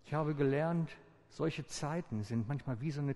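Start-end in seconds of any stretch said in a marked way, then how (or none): none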